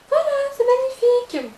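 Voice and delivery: high, loud mock rave; sarcastic high-pitched delight; mock gushing. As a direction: squeal it as a fake rave.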